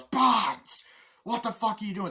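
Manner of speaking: high shout, loudest on the name; imitated outraged officer; acted angry confrontation. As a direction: bellow the name, then demand answers. Acting officer confronting Barnes.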